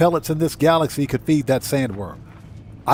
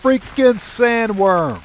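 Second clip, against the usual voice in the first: startled exclamation; 7 semitones higher, 3 dB louder, much slower than usual. Real, not acted. Startled by sandworm.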